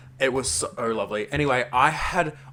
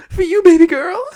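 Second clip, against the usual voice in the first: very high and loud, squealed; high shrieking devotion; 16 dB louder than usual. Retold or imitated. Swooning at a French stranger, loud high squeal.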